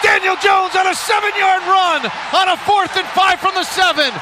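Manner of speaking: shouted radio play-by-play; touchdown call. Real, not acted. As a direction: call the touchdown live, full voice.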